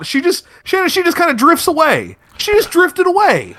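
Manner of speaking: high, loud airy drift-off. Acted. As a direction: float away in a high voice. Mimics drunk woman drifting off.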